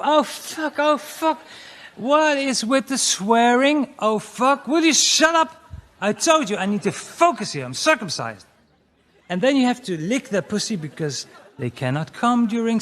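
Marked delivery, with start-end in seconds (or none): 0.0-1.4 high, breathy-free hammed-up cursing; mock high moaning. Comic imitating a woman swearing in bed, high mock moan. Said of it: "And they would go". 1.9-8.4 mock annoyed self. Comic argues with partner in bed.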